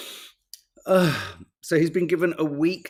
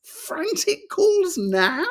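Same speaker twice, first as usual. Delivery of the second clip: much higher, slow disbelief; stunned mocking disbelief; incredulous; 12 semitones higher, slower than usual. Real, not acted. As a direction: drag it out in disbelief. Incredulous at headline.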